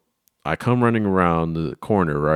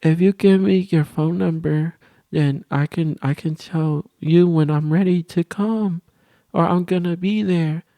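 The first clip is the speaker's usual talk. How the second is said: put-on high, slow gushing girl; mock girly gush; 10 semitones higher than usual. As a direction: announce it like a proud little girl. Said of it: "she's over here".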